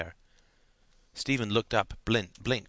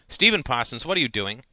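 sharp, raised teacher's voice; sharp cry; 3 semitones higher, 6 dB louder than usual. A narrator's reading. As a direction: call out a pupil sharply. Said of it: "cried out sharply".

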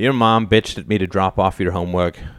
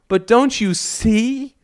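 high, slow, imploring question; drawn-out impassioned appeal; emotional plea; slower than usual. A narrator's reading. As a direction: beg them to understand, voice lifted. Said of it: "broke into an emotional depth".

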